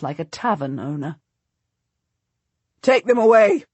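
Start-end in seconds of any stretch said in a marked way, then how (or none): 2.8-3.6 much louder, higher insistent command; repeated order, louder. A narrator's reading. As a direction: say it again, harder, no patience left. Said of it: "Salisbury repeats".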